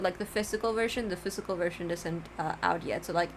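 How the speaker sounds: normal talking voice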